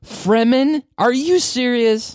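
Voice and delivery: high, loud disbelief; incredulous. Real, not acted. Surprised at being corrected.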